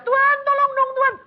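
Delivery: very high, loud begging cry to God; pleading new believer. Retold or imitated. Petobat baru memohon kepada Tuhan dengan suara sangat tinggi dan keras. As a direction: wail for help at the first small trouble. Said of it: "dia baru kesulitan sedikit sudah".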